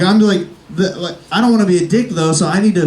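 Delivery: ordinary talk, nothing marked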